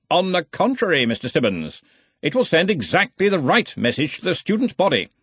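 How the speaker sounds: head wizard laying down the law; blustering Arch-Chancellor. Acted. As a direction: chide your assistant with hearty authority. Ridcully overrules Ponder.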